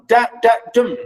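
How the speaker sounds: da-da-DUM as a metre demo; anapestic rhythm. Shown as a demonstration. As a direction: chant two light das into a final DUM. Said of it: "this is the musicality about it".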